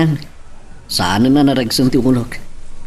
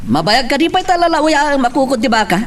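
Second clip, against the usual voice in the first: calling out; 9 semitones higher than usual. Acted. Husband calls out to wife.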